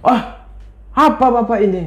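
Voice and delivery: acted high-pitched indignation; wife's offended outburst, as told by a storyteller; angry. A narrator's reading. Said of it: "Marah ibu ini".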